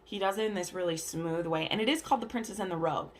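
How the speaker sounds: ordinary delivery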